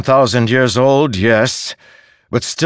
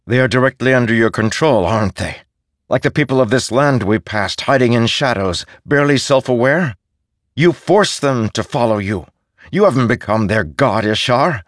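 rising, forceful accusation; defiant challenge; 7 semitones higher, 3 dB louder than usual. Acted. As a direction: stand up to a false god. Kaladin confronts Ishar.

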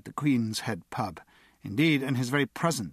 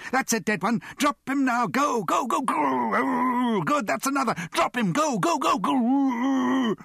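frenzied shouting; 13 semitones higher, 5 dB louder than usual. A narrator's reading. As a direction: scream orders at your fighting dog. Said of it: "shouted Mister T".

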